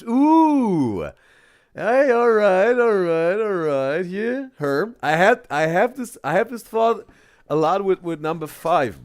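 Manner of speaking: loud, high, hyped-up calls of all right; excited cheering. Real, not acted. Reactor cheering his dialect coach guess, excited loud shout. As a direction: cheer the guess like a sports fan.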